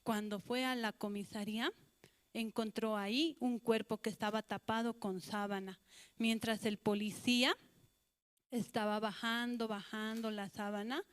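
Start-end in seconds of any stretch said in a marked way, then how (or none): none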